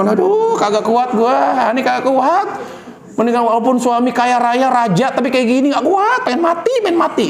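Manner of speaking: high, whiny gossiping housewife; mock whining complaint. Preacher mocking a wife complaining to neighbours, whiny. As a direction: whine to the neighbours that you cannot bear it.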